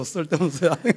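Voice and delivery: "쓸데없는 소리 하네" said through a laugh, rising at 하네; amused retelling with a laugh. Retold or imitated. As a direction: chuckle while repeating the brush-off. Repeats husband's line laughing.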